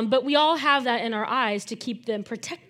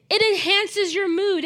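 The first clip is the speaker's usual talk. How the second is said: usual speaking voice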